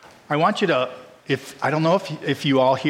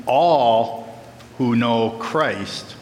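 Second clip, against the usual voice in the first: slow emphatic; much slower than usual. Shown as a demonstration. Explains emphatic Greek word.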